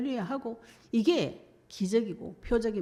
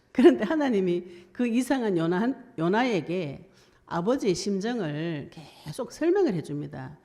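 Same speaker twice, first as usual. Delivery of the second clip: ordinary talk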